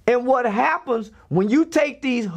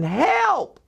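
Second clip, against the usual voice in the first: retold scream for help; 5 semitones higher than usual. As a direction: imitate a teacher's scream without really screaming. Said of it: "This teacher is screaming".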